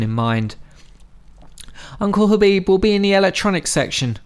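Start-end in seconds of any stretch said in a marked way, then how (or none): 2.0-4.2 higher, called across the store; calling out. A narrator's reading. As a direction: call it out to Fadi. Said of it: "Zalmay called out".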